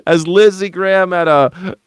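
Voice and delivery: high-pitched, helpless giggling through the words; trying to repeat the joke while cracking up; squeaking it out between laughs; laughing. Real, not acted.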